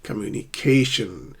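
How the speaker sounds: "communication" with a stressed CA; stress on second-last syllable. Shown as a demonstration. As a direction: lean hard on CA in "communication". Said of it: "stress the second last syllable".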